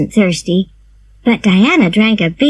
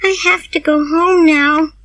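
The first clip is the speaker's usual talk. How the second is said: Diana's voice; 8 semitones higher than usual. A narrator's reading. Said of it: "said Diana".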